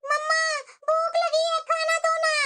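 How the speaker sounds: put-on a small hungry girl tugging at mom; little girl voice. Little Chinki begging her mother for food.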